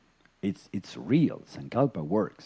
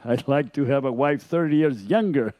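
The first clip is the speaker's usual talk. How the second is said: put-on a little louder, laughing through the old man's wish; 4 semitones higher, 4 dB louder than usual. Teacher telling the genie joke to the class, laughing. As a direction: voice the husband's cheeky wish while laughing. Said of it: "And the man goes".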